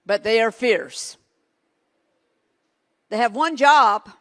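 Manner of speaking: fierce preaching; preaching loud. Real, not acted. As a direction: drive it home. Preaching at force about heaven's army.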